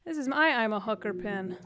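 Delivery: usual speaking voice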